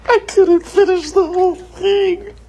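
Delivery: high, laughing through tears; can barely talk from laughing; crying laughing. Real, not acted.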